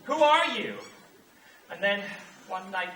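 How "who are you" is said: louder repeat. Retold or imitated. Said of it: "you think".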